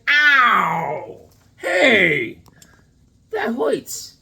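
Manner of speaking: loud, high cartoon yelp of pain; hammed-up indignant hurt shouting; mock pained yell. Retelling the hero getting shot, mock pained yell.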